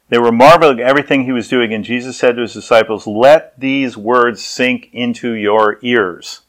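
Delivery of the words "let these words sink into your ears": very slow, weighted words; deliberate emphasis. Acted. Jesus instructing his disciples, slowly and deliberately. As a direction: space out each word so it lands.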